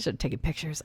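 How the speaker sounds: unvoiced spooky whisper. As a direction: breathe it like a secret. Spooky whisper about haunted well.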